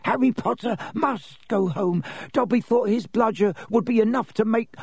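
high, urgent elf voice; pleading little house elf; Dobby the elf, urgent. A narrator's reading. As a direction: blurt it out in a high-pitched voice. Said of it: "said suddenly".

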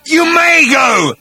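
slow, high, loud threat; menacing shout. Acted. Agrajag granting Arthur leave to go, menacing shout. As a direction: draw each word out in a high shout.

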